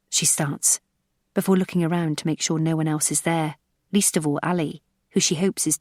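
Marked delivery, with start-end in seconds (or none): none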